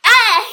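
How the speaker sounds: sudden loud yell; shout. Shown as a demonstration. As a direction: yell to max the meter. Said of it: "So if I go like".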